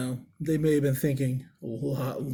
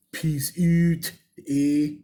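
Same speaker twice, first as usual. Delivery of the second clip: louder, hammed-up Canadian sign-off; mock Canadian accent; 5 dB louder, much slower than usual. Host signing off as they say in Canada, mock Canadian accent. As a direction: put on a jokey Canadian accent, punched out. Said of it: "as they say in Canada".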